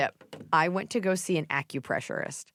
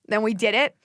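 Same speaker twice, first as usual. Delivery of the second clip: usual speaking voice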